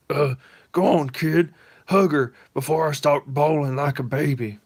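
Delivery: big softie holding back tears; gruff Bibbo. Acted.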